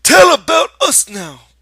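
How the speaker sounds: loud, high excited shout; eager demand. Acted. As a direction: demand the story joyfully. Lenny demanding happily.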